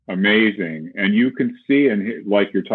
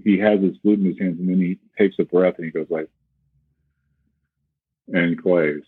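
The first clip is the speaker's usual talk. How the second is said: ordinary talk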